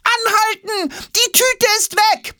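very high, drawn-out yell; alarmed screaming. A narrator's reading. Jonas to the flying suitcase, bag lost, screaming. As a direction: cry out urgently, pitched high. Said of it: "schreit Jonas".

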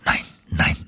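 hushed, to himself; whispered no. Acted. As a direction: whisper the refusal under your breath. Narrator voicing inner refusal.

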